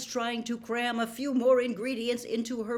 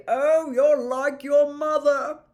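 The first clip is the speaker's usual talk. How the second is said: put-on jovial, booming old professor; plummy teacher inspecting the class's work; Slughorn's voice; 6 semitones higher, 9 dB louder than usual. Slughorn to the class, checking potions, jovial booming.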